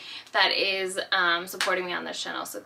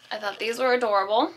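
normal talking voice

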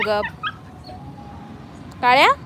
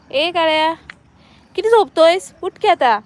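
higher, louder playful nagging; teasing wake-up call; 5 semitones higher, 5 dB louder than usual. Real, not acted. Owner teasing her sleeping dog awake, loud playful call. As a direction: rouse the lazy dog.

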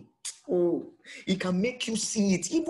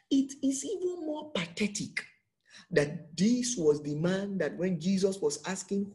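normal talking voice

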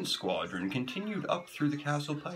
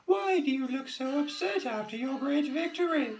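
princess voice; 14 semitones higher than usual. A narrator's reading. Princess voice continues.

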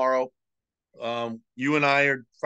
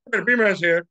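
B-man swagger; 3 semitones higher, 7 dB louder than usual. Retold or imitated. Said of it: "he calls himself the B-man".

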